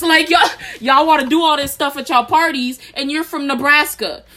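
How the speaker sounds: loud, high, worked-up rant. Real, not acted. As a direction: call them out, loud. Ranting at Nebraskans.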